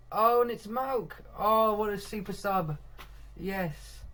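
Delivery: loud, high, stretched-out celebration; excited goal call. Real, not acted. Commentator cheering the super sub's goal, excited. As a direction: burst out as the goal goes in.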